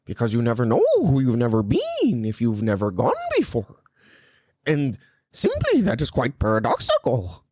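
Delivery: pompous pseudo-intellectual drone; Jordan Peterson. Acted. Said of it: "Jordan Peterson kept trying to fucking steal my show".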